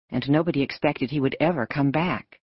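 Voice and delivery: plain reading voice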